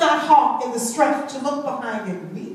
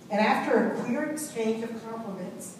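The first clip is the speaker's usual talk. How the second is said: normal talking voice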